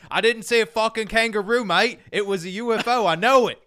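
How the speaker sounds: an indignant Aussie bloke, hammed up; Australian accent. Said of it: "And he's like".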